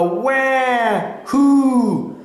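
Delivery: high wail, then long hoot; seal calls. A narrator's reading. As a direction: imitate two seals calling. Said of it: "far away there came a".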